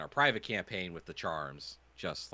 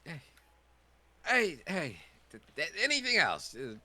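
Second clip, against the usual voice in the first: high, loud, outraged haggler; pushy shopkeeper; 8 semitones higher, 5 dB louder than usual. Acted. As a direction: defend the steep price, loudly. Shopkeeper NPC voice.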